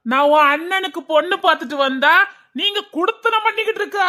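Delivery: high, outraged scolding; angry shouting. Acted. Father catching his son with a girl, shouting angrily. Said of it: "அப்பா கத்தாதீங்கப்பா".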